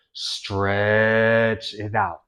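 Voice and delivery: very slow, each word drawn long; stretched out. Shown as a demonstration. Teacher stretching out Stretch it out, slow and loud. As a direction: drag every syllable of Stretch it out. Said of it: "I could go".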